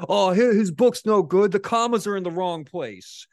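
high, loud, snooty mockery; mocking critic. Mocking non-readers who insult a writer, high snooty voice. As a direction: ridicule the know-nothing critic. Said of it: "they'll say something like".

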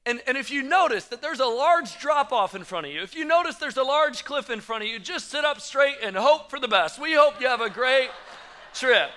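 cheerful safety-video announcer, shouting the send-off at the end; the rafting company's intro video, mock announcer; mock safety video narrator. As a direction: play the upbeat tour video warning of doom. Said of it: "they say".

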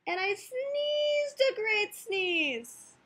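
very high, greatly stretched words; drawn-out sneeze build-up. Acted. Wolf telling of his great sneeze, high and drawn out. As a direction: stretch it out like a huge sneeze coming.

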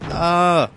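high loud yelp of fright; startled scream. Real, not acted. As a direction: scream at the jump scare. Sudden scare.

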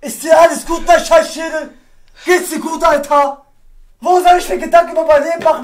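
furious rant. Real, not acted. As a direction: rip into him like an exasperated sibling. Wütende Standpauke an den Bruder. (wütende, laute Schimpftirade, höher)

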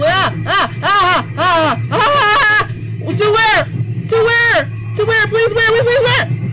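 panicked high-pitched pleading; frantic, breathless begging; terrified gibbering. Acted. Fleeing the man in panic.

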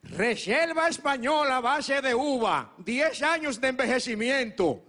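voz de viejito catador, lenta y pausada. Acted. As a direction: saborea cada palabra como un viejo experto. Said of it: "el viejito hizo así". (voz de viejito catador, lenta)

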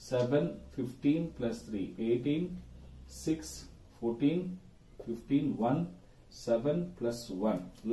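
low, soft self-directed adding up; counting to oneself. Real, not acted. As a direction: tot up figures under your breath, with pauses. Adding up figures to himself. (ತನಗೆ ತಾನೇ ಮೆಲ್ಲಗೆ ಲೆಕ್ಕ ಹಾಕುತ್ತಾ)

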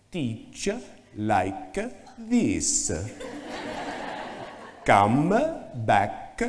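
slow, measured Italian accent, lightly put on; a hint of a stern Italian boss; mild Italian accent, words spaced out slowly. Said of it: "in her very strong Italian accent".

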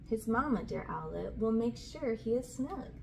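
ordinary delivery, nothing marked

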